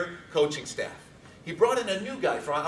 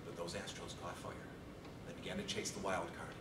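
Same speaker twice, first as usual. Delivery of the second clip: much softer and lower, heavy; subdued grief; 8 semitones lower, 14 dB quieter than usual. Real, not acted. Speaker recalling dad's death, subdued grief. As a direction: speak quietly of a lost father.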